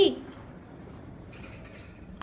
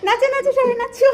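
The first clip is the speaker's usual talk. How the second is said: high, loud call; rally everyone at full voice; excited cheering; 11 semitones higher, 7 dB louder than usual. Real, not acted. Parent urging the family to dance, loud and excited.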